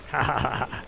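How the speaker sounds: ordinary talk, nothing marked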